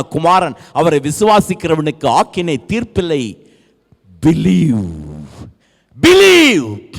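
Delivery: high shouted exhortations between emphatic declarations; impassioned preaching. Real, not acted. Preacher urging congregation to believe in Christ, impassioned preaching. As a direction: preach with bursts of full-force calling.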